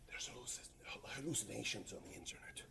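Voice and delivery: hushed whisper, as if confiding; whispered aside. Acted. Presenter explaining AI hallucinations to the audience, whispered aside. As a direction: drop to a secretive whisper. Aside while dictating a search prompt.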